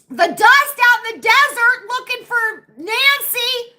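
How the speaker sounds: high, loud theatrical declaration; dramatic outburst. Real, not acted. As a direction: proclaim it like an epic quest. Dramatic desert quest rant.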